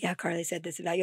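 soft, quick, low-pitched aside imitating dad; casual tattling. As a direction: slip it in quietly and fast, as a gossiping dad.

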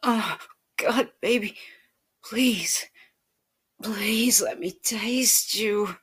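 high, loud pleading, slowing at the end; almost begging. Acted. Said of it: "almost begging".

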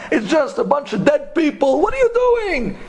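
acted-out exasperated cabbie; exasperated outburst. Taxi driver challenges the scholar praying at graves, exasperated. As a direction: pitch soaring in exasperation.

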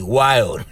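"wild" drawn out slowly; stress on wild. Shown as a demonstration. Host picks out the root word for listeners, stress on "wild". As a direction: lean on "wild" and stretch it. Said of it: "I wanna stress the word".

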